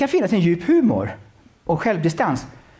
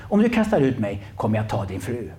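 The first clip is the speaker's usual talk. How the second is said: a hint of a low demon, lower pitch; understated demon threat, voice a bit lower; lightly voiced demon; 7 semitones lower than usual. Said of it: "ska inte göra mig till i rösten för mycket".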